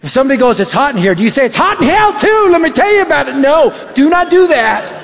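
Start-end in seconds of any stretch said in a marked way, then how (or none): 1.5-3.4 high-pitched, pouncing zeal; hammed-up pushy preacher seizing the opening; mock overeager evangelist. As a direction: blurt it high, far too keen.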